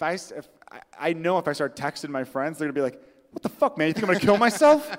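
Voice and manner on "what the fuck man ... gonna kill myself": acted-out offended disbelief, pitched high; hammed-up affronted friend; mock indignation. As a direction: rise into a loud, incredulous mock protest. Acted indignant reply from texted friends.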